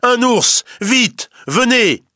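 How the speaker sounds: alarmed shout. A narrator's reading. Forester running to the village, shouting an alarm. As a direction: yell the alarm while running. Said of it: "il court vers le village et crie".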